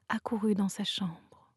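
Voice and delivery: ordinary delivery, nothing marked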